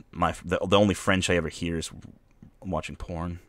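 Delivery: ordinary delivery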